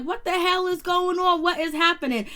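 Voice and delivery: high, loud startled outburst, jolted awake; startled confusion. Retold or imitated. Alex jolted awake by Donna, startled and confused. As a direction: blurt it out like someone shocked out of sleep. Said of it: "And Alex is like".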